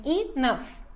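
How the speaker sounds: ENOUGH split slowly into e and nough; two syllables. Shown as a demonstration. Teacher counting syllables in English enough, two syllables. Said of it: "São duas sílabas".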